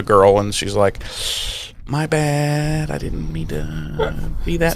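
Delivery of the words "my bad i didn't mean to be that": soft, small mock apology; hushed, drawn-out imitated apology; normal Willow apologizing. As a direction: shrink into a quiet, sheepish sorry. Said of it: "her normal self".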